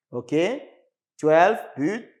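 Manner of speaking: ordinary delivery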